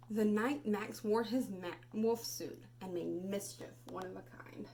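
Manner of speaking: put-on expressive read-aloud for children; storybook reading. Teacher reading Where the Wild Things Are to class, expressive.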